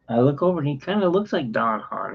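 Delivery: ordinary talk, nothing marked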